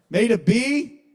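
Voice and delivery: high, loud disbelieving question; mock incredulity. Child shocked at getting a grade, loudly incredulous. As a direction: shoot up in pitch as if appalled.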